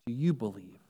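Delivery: hushed, low pleading appeal to faith; soft altar call. Acted. Preacher softly asks the congregation if they truly believe, hushed. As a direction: draw them in quietly, almost confiding.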